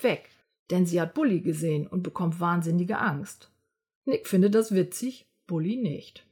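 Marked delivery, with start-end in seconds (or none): none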